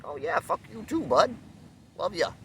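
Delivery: an innocent buddy who takes the insult as a compliment; cheerful reply. Retold or imitated. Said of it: "I was like".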